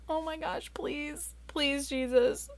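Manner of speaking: soft, high, anxious begging; pleading prayer. Retold or imitated. Mom praying her daughter likes the party, soft pleading. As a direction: pray desperately under the breath, slowing. Panicked mother prays.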